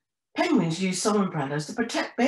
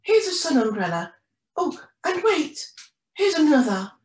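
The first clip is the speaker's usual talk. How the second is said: higher, louder storybook mum; helpful penguin mum; 8 semitones higher, 5 dB louder than usual. Acted. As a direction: hand it over with lifted pitch and volume. Mum hands over umbrellas in story.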